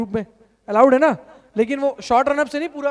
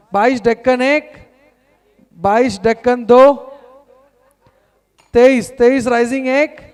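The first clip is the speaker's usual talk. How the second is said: rising bid calls, louder; auctioneer calling bids; 7 dB louder, slower than usual. Acted. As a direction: call out each bid like an auctioneer.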